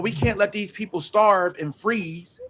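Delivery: normal talking voice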